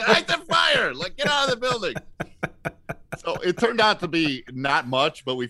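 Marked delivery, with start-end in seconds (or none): 0.1-0.9 high, drawn-out angry yell; angry shout. Retold or imitated. Mimicking the plumber in the building, a high angry shout. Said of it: "the guy said". 1.2-1.9 raised-pitch warning; urgent yell. Retold or imitated. Plumber warning the crew, a mock urgent call. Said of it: "the guy said".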